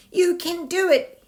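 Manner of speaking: loud, high call from below; a big high cheer upwards; yelled encouragement. Acted. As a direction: shout up to your friend to cheer him on. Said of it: "yelled Tiny from below".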